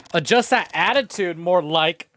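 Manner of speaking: usual speaking voice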